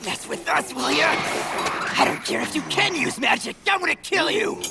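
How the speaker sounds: high, rising furious threat; enraged thug going for the kill; angry threat. Acted. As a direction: spit out a death threat at your foe.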